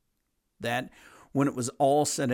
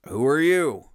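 scornful challenge; 4 semitones higher, 7 dB louder than usual. Retold or imitated. Mocking a leader who pulls rank.

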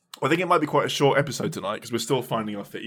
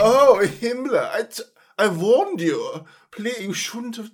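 slow, high mock-scolding Hitler; 9 semitones higher, 6 dB louder, slower than usual. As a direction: fake dismay at a leaked secret. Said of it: "And Hitler like".

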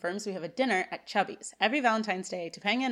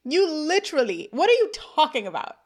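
higher, louder mock exasperation; incredulous; 7 semitones higher, 7 dB louder than usual. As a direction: call him out in disbelief. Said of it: "I'm like".

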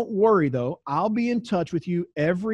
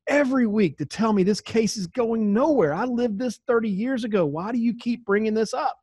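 exasperated, higher-pitched imitated outburst; mad; 5 semitones higher than usual. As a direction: act out a fed-up woman demanding an answer. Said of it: "And she just gets mad".

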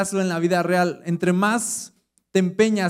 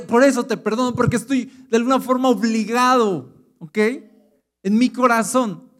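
louder, higher preaching push, building to a peak; fervent preaching; 5 semitones higher, 6 dB louder than usual. Acted. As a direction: preach it hard to the church. Preaching at full force.

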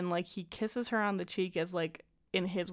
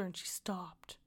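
mock whisper; 2 semitones lower, 11 dB quieter than usual. Voicing Luke's mommy-issue whine.

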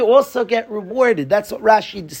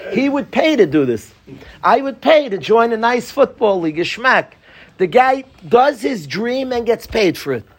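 ordinary talk, nothing marked

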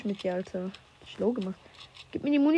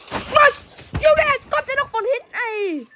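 high furious yelling; enraged boss roar; 14 semitones higher, 8 dB louder, slower than usual. Real, not acted. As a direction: scream it out in real rage. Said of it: "jetzt bin ich wütend".

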